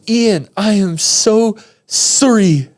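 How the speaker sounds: high, slow imitated Canadian accent. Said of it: "in her little Canadian accent".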